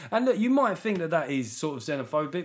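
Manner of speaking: ordinary delivery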